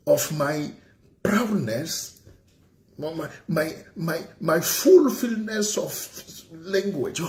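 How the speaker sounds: slow, grandiose English boast; hammed-up posh English; pompous English. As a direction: parade your fancy English. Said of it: "quand je détale mon anglais là".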